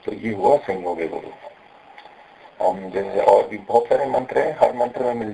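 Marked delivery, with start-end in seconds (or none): none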